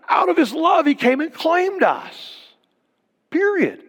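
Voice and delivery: preaching shout. Real, not acted. Preacher building to climax.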